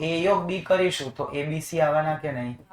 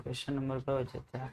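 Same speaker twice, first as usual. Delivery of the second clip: asked at a near-whisper level, much softer; very quiet; 13 dB quieter than usual. Shown as a demonstration. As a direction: drop the voice right down to test the class. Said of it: "હું કેટલું ધીમું બોલું?".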